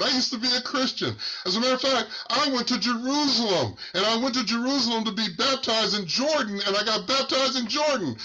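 a man waking up to his wasted life; anguished realization. Retold or imitated. Retold man's anguished realization.

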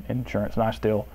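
ordinary talk

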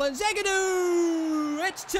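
long drawn-out goal call; elongated excited holler; goal shout. Real, not acted. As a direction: stretch the scorer's name in celebration. Calling a goal.

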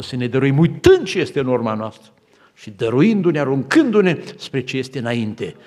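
loud, rising exhortation; preaching at full force. Acted. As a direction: urge them forward with full voice. Closing exhortation.

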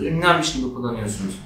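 ordinary talk

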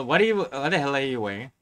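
ordinary talk

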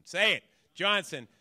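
loud, urgent call of a name. Retold or imitated. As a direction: shout the name across the room. Acted kid calling out to counselor.

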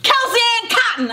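high, fast call up a tree; sharp, high-pitched summons; yelling. A narrator's reading. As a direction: yell the full name up to a sister. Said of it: "I yelled up the backyard".

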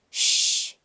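sharp unvoiced shush. A narrator's reading. Audience shushing the noisy toddler, sharp loud shush. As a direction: hush the noisy kid. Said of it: "the people in front of us said".

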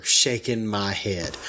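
normal talking voice

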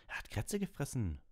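high-pitched, indignant outburst; angry interjection. A narrator's reading. Ron angrily interrupting Hagrid about the cat. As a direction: cut in crossly, voice jumping up. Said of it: "warf Ron zornig ein".

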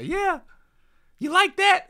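very high squeaky taunt; Blocky voice. Retold or imitated. Streamer voicing Blocky taunting, very high. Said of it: "I don't know what he'd say".

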